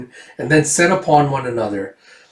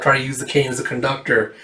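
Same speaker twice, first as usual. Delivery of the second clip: ordinary delivery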